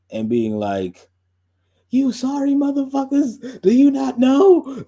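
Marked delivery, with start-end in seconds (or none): 1.9-4.8 high, loud, squealing outraged voice, slowing at the end; mock furious rant. Streamer mocking an outburst at R&B doubters, hammed-up high shriek. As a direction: ham up a fake meltdown at the doubters. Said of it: "Rather than getting upset".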